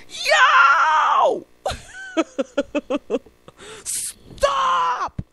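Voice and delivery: high, drawn-out shout; cop yelling. Retold or imitated. Cop shouting at a pedestrian running into traffic, exaggerated yell. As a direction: holler it out in mock alarm. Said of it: "he yells at the dude".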